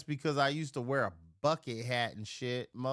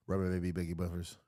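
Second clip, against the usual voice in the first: put-on rapid "Rubber baby buggy bumpers"; fast tongue twister; 4 semitones lower, faster than usual. Shown as a demonstration. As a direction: rattle it off quickly. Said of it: "repeating tongue twister".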